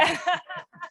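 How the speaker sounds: high bright laugh at her own joke; real laugh. Real, not acted. Prime Minister laughs after joking about the UN, a real laugh. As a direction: laugh off the quip.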